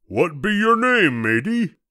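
high pirate questioning; head pirate. A narrator's reading. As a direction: ask it as a storybook pirate. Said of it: "the head pirate asked".

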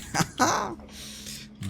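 sudden high-pitched laugh burst. Real, not acted. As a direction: cackle at your own joke. Laughs at own joke.

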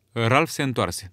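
plain reading voice

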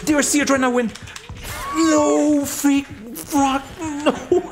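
shrieking no in high panic; high-pitched panic. Real, not acted. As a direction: yelp in high-pitched dismay. Losing the match, panicking.

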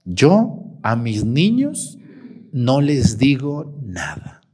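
low, slow imitation of a mother; permissive mother imitated. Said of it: "Me dijo".

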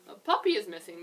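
a big, loud public announcement. A narrator's reading. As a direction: call it out loudly to the gathered pups. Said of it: "Miss Bulldog announced".

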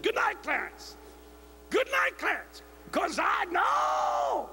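high, slow, shouting preacher; preaching at full force. Shown as a demonstration. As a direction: preach it to the rafters. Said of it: "I can do that, see".

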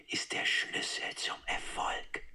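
breathy secret whisper; hushed whisper, no voice at all. Shown as a demonstration. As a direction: whisper Marketing ist der Schlüssel zum Erfolg. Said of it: "flüsternd".

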